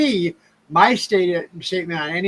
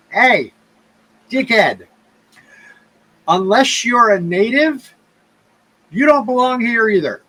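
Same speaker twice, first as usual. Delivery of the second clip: louder, deliberate telling-off; imagined retort; 4 semitones higher, 5 dB louder than usual. Retold or imitated. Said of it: "thinking to myself".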